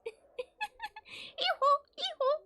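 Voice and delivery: high hee-haw through a laugh; laughing donkey bray. Acted. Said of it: "bray like donkeys".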